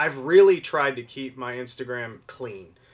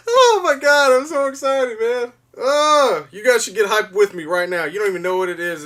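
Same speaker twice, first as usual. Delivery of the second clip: much higher and louder, near-shouting; real excitement; 9 semitones higher, 9 dB louder than usual. Real, not acted. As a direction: burst out with joy.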